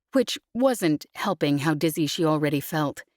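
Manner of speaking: ordinary delivery, nothing marked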